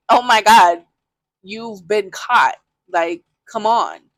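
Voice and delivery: loud, disbelieving, dragging out the last words slowly; oh-my-God, you've been caught, exasperated; exasperated outburst. Retold or imitated. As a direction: call him out, fed up and loud. Confronting cheating boyfriend in bed.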